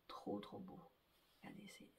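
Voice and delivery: soft, breathy whisper of delight; hushed admiration. Real, not acted. Showing viewers the skein, whispering in quiet awe. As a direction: whisper as if the skein were too precious to disturb.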